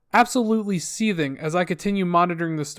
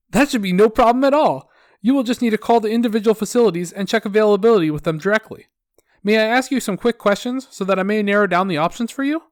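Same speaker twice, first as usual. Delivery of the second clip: louder, upbeat customer service patter; sickeningly positive; 4 semitones higher, 4 dB louder than usual. Acted. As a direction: play the relentlessly helpful phone rep. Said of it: "my sickeningly positive customer service attitude".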